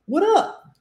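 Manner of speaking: "What up?" with the S dropped, run together, with a laugh. Shown as a demonstration. Teacher demos the slangiest form, laughing. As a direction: merge it all into one chunk and laugh. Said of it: "esquece do S, junta tudo".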